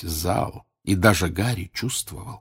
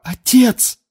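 high, loud desperate shout; a son's frantic plea, much higher; desperate cry; 7 dB louder than usual. A narrator's reading. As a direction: cry out to your father in terror. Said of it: "крикнул юноша".

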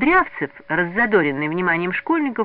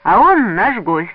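slow, emphatic, raised voice; loud and deliberate, higher pitch; loud declaration; 6 semitones higher, 7 dB louder, slower than usual. A narrator's reading. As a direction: announce it firmly to everyone. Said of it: "громко сказал".

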